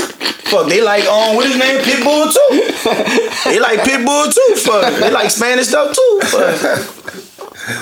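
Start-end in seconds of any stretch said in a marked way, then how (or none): 1.3-7.8 high, laughing through the words. Real, not acted. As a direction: giggle while saying it. Laughing at himself.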